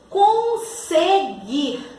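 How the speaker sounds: "Conseguir" very slow, con-se-guir, with a clear S; syllable by syllable. Shown as a demonstration. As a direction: sound out "Conseguir" in separate syllables. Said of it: "Está com som de Z o S?".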